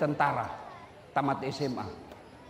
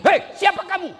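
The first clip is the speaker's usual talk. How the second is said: startled shout; 12 semitones higher, 7 dB louder than usual. Acted.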